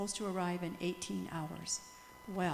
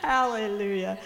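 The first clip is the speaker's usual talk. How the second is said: louder, ringing hallelujah; joyful hallelujah; 11 dB louder than usual. Real, not acted. Missionary to the congregation, a ringing joyful hallelujah. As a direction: praise the Lord out loud.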